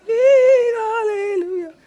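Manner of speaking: high falsetto sung hallelujah; lady voice. Retold or imitated. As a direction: sing it like the church ladies, up high. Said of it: "that was my lady voice".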